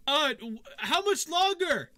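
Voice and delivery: high, loud and slow hesitant question; guard reopening the gate to ask. Acted. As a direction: call in again, unsure. Guard calls again, hesitant.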